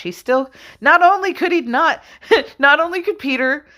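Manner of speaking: high-pitched, laughing disbelief; laughing exasperation. Real, not acted. Preacher laughing about Peter's failure, high and amused.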